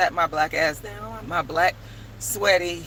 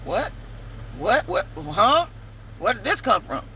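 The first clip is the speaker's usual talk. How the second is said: mock confused; 3 semitones higher than usual. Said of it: "I'm looking at him like".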